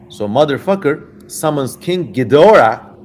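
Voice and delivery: ordinary talk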